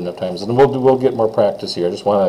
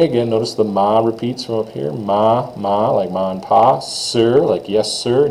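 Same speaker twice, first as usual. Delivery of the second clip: usual speaking voice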